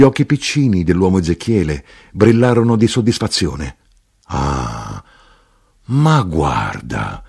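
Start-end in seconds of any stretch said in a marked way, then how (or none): 4.3-7.2 slow, low exclamation of discovery; exclaiming. A narrator's reading. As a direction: exclaim it low and drawn out, as Porfirio. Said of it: "esclamò l'uomo Porfirio".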